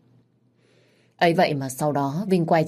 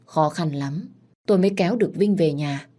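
ordinary delivery, nothing marked

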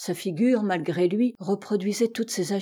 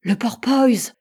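higher, louder exclamation; astonished cry; 4 semitones higher, 7 dB louder, slower than usual. A narrator's reading. Captain cries out on hearing the ship's name, astonished. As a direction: seize on the word, crying out. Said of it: "s'écria le capitaine".

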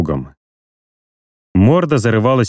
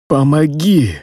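higher, pleading cry for help; desperate cry; 6 semitones higher, slower than usual. Acted. Dying man begs again.

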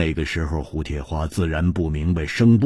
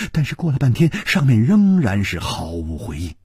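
plain reading voice

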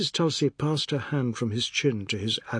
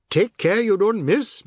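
louder, higher teasing quip; dry joke; 9 semitones higher, 7 dB louder than usual. A narrator's reading. Biswas mocking Oad's tale, teasing. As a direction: poke fun at him.

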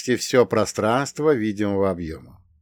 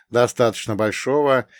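ordinary delivery, nothing marked

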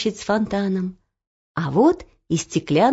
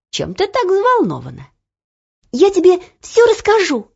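put-on young girl voice; 11 semitones higher than usual. Olya excited.

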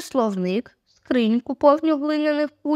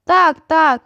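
usual speaking voice

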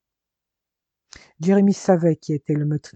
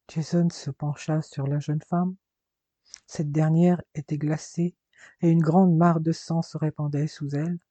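ordinary delivery

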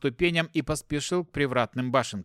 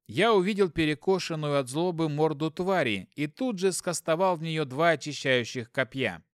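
plain reading voice

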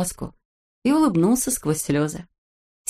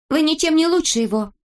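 high-pitched reproach; indignant exclamation; 10 semitones higher than usual. A narrator's reading. Snezhinka rebukes the teasers, indignant exclamation. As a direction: scold them in a raised pitch. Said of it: "воскликнула Снежинка".

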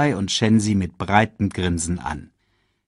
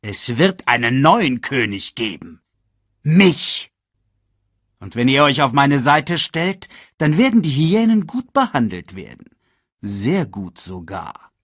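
grand, raised villain's voice; scheming Scar; 6 semitones higher, 5 dB louder than usual. A narrator's reading. Villain winning hyenas over, grand and scheming. Scar proclaims himself king.

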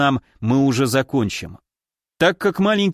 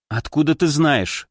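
ordinary delivery

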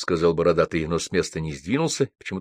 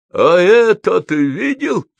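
high, loud, slow furious yell; 12 semitones higher, 7 dB louder, slower than usual. A narrator's reading. Гоголь challenges his friends, banging the table, yelling. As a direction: roar the challenge across the table. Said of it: "заорал Гоголь и ударил кулаком".

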